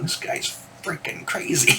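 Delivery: hushed whisper to a friend. Retold or imitated. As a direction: lean in and whisper it. Friend whispering aside at concert.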